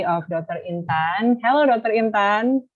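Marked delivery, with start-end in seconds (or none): none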